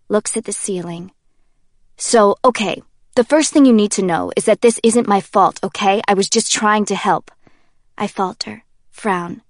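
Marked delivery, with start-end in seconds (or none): 2.0-7.3 loud, agitated Kenji protesting innocence; Kenji, defensive. Acted. Kenji insists to Jay it isn't his fault, loud and defensive. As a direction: talk fast and loud, pleading it's not his fault.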